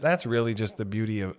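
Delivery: ordinary talk, nothing marked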